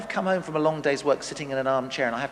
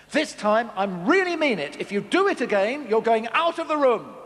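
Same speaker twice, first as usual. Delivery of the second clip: a bit higher, louder third warning; really mean it; 6 semitones higher, 4 dB louder than usual. Retold or imitated. Parent warning child a third time, mock stern. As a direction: lean on it as if you mean it this time. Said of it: "mum or dad says".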